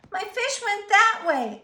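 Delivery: put-on high, loud urgent boy's voice; eager child calling for help; excited boy. A narrator's reading. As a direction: blurt it out to the policeman. Boy tells policeman urgently.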